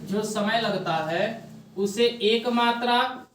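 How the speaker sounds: slow, soft dictation with long pauses; dictating notes slowly to the class; dictation pace. Shown as a demonstration. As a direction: read it out phrase by phrase for writing down. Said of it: "लिख लो".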